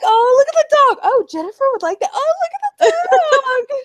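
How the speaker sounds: high, loud cooing; gushing. Retold or imitated. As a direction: squeal over the dog. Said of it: "I'm like".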